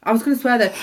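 usual speaking voice

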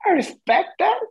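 high, put-on Karen voice. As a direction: play an entitled Karen. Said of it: "Karens say that a lot".

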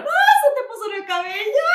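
loud, high sudden yelp; startled alarm. Real, not acted. Friend spots a bug in her hair, loud high startled cry. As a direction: shriek the warning at once.

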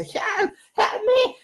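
high pleading cry for help; imitating desperate House. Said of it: "ve a Cody así a veces y dice".

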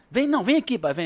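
imitating a kid begging his dad to come look; son calling urgently. Father imitates his son eagerly calling him over, excited.

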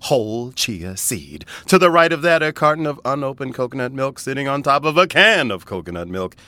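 loud, high showman announcing; carnival barker voice. Pretend. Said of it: "practicing my carnival barker voice".

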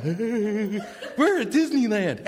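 slow, laughing fake cheer; forced cheer. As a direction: say it through a forced smile. Forced smile at church.

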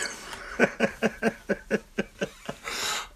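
airy laugh at a failed Irish accent; breathy giggle. Real, not acted. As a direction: laugh at yourself, breathy and high.

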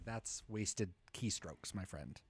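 low, quiet, slow put-down; deadpan murmur, drawn out; dry muttered reply. Pretend. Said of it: "I would say".

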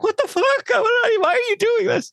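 very high falsetto wail of protest; hammed-up high-pitched distress; mock panicked squeal. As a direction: squeal it as a bewildered, helpless victim. Voicing panicked man being filmed.

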